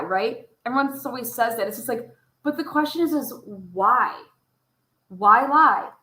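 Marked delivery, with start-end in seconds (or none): none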